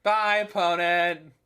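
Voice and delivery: long, drawn-out slow farewell; slow, taunting goodbye; gloating send-off. Real, not acted. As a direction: wave off a beaten opponent, stretching the words. Sends off beaten opponent.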